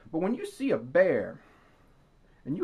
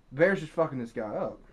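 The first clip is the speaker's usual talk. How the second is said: ordinary talk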